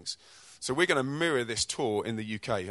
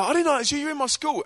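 put-on Scottish kids; 5 dB louder, faster than usual. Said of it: "that's how they talk".

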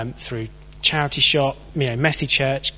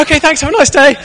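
chirpy retreat; 13 semitones higher, 9 dB louder, faster than usual. Retold or imitated. Brushed off, cheerful retreat.

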